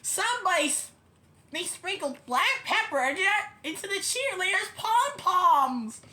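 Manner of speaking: lightly acted outcry; fan calling out. A narrator's reading. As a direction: report it from the stands. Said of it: "shouted a fan in the bleachers".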